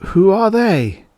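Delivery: high, awed faltering question; stunned, voice catching. Acted. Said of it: "Engel faltered".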